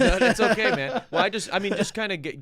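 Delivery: laughing. Real, not acted. Laughs while speaking.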